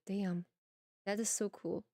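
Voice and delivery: normal talking voice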